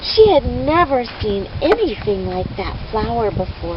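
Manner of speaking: plain reading voice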